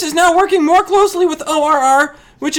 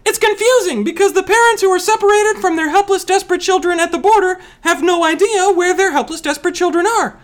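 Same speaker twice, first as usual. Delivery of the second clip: put-on higher-pitched voice; raised pitch held through the line; 3 semitones higher than usual. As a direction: lift the whole explanation up higher.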